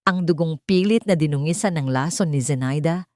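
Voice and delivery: ordinary delivery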